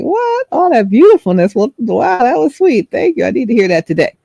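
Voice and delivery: high, delighted "What?" then thanks; surprised and touched by a stranger's compliment; woman thanking the stranger, high and delighted; pleasantly surprised. Retold or imitated.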